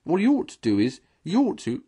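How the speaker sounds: high, loud, cutting in; insistent arguing. Acted. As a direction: talk over the others. Mole arguing over Rat.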